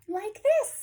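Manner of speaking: put-on loud, high lead-in for children; big animated announcement. Reader introduces a chicken's hello, loud and high. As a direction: call it out high and bold.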